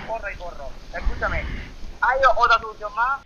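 ordinary talk, nothing marked

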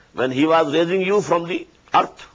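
ordinary talk

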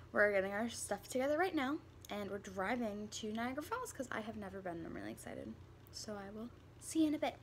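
ordinary delivery

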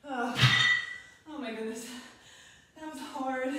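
loud, winded sigh of relief; breathless relief. Real, not acted. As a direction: gasp it out after the last rep. Relief after the final set.

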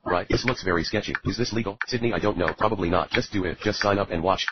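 normal talking voice

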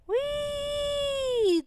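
very high, long held whee; gleeful squeal. Acted. Little sister being carried by her big brother, gleeful squeal. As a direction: squeal with glee on one sustained high note.

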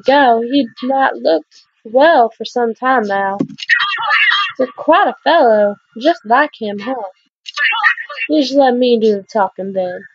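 put-on Southern Belle accent. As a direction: play a sweet Southern Belle greeting the new miner. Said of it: "good Southern Belle accent".

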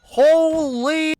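startled yell. Real, not acted. Startled exclamation in game.

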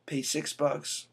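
ordinary talk, nothing marked

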